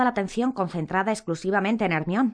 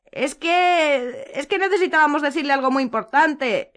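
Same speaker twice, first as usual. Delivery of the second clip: whimpering; 9 semitones higher, 6 dB louder, slower than usual. A narrator's reading. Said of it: "gimió Hermione".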